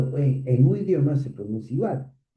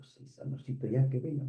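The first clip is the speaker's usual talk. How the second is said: wife's soft reply; 11 dB quieter, faster than usual. Retold or imitated. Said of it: "mi esposa me dijo".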